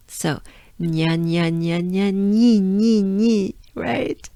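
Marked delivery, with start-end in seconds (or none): 0.8-3.6 "nya" repeated, turning into a louder "ni"; nya into banana smile. Shown as a demonstration. Teacher shows one sound going into another, nya into banana smile. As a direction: run "nya" on and spread it into "nyee" for "ni". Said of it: "nya goes into the banana smile".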